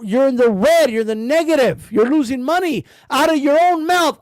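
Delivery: ordinary talk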